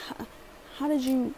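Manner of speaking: ordinary delivery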